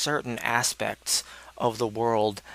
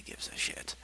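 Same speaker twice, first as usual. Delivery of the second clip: unvoiced throwaway whisper; whispered aside; 12 dB quieter than usual. Real, not acted. Telling viewers nobody cares, in a whisper. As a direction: drop to a whisper.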